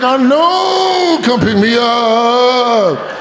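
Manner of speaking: very high, drawn-out crying wail; hammed-up sobbing plea from a friend; mock wail. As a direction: bawl it, stretching the last words long. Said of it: "really crying right now?".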